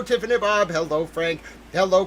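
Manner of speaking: normal talking voice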